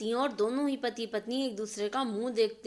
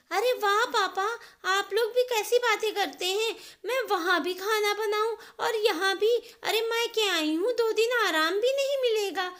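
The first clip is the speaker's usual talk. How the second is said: much higher, loud indignant whine with a scoffing laugh; scoff, then demand your rest; outraged spoilt daughter; 10 semitones higher, 6 dB louder than usual. Acted. Neha scoffing at her father's request, high and indignant.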